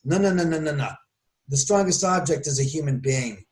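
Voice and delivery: ordinary talk, nothing marked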